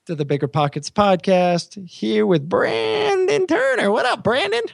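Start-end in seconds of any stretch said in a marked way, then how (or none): 1.9-4.6 rural Washington voice. Acted. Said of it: "Was that your, like, grandpa voice?".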